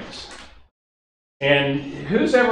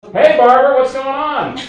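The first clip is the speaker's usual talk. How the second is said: high, loud hello to a stranger; eager phone greeting; 11 semitones higher, 8 dB louder than usual. Retold or imitated. Answering an unknown caller's phone call, a high, loud cheery hello. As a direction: greet her like an old friend, up and loud.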